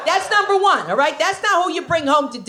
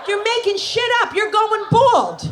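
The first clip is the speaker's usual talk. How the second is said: outraged shout; 9 semitones higher than usual. Retold or imitated. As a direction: yell it at the hairdresser. Panicked retort to hairdresser.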